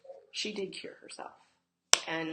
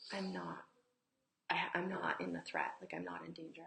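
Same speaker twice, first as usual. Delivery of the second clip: whisper; 4 dB quieter than usual. Shown as a demonstration. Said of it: "whispered what-if questions".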